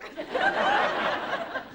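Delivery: a sudden high laugh. Real, not acted. Laughing at leaving the audience out, high laugh. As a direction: let a real laugh burst out.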